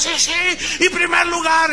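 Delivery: high-pitched mock euphoric cheering. Staff worked up over first place, euphoric. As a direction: imitate a worked-up crowd. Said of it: "están todos ahí bien eufóricos".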